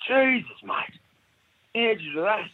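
a gobsmacked barman asking how; bewildered bartender. Retold or imitated. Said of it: "Stands back and goes".

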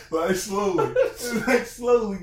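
high, loud words through laughter; real laughing. Real, not acted. Host cracking up at his own dating warning, laughing. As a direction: crack up while repeating the point.

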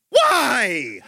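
high-pitched mock child's whine; pestering little kid. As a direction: squeal it like a nagging three-year-old. Three-year-old keeps asking why.

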